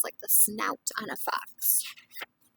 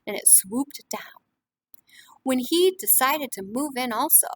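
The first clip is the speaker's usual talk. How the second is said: lively storybook reading to a class; reading to children; 9 dB louder than usual. A narrator's reading. Teacher reading a picture book to the class, lively. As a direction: read it warmly to young listeners.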